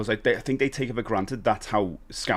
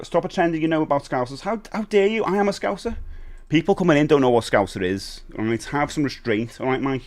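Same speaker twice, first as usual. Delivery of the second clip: ordinary talk, nothing marked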